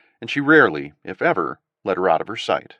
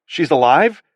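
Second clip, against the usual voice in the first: louder, higher amazed question; stunned disbelief; 7 semitones higher, 7 dB louder, slower than usual. Acted. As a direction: ask it in wonder. Stunned father, said to no one.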